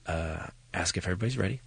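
low, hushed prompt; Bryan Cranston. Retold or imitated. Famous actor leaning in to coach the novice director, low and hushed. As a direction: murmur a discreet cue, very low and soft. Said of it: "Bryan leans in and he goes".

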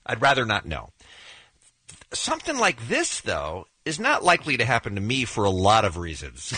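normal talking voice

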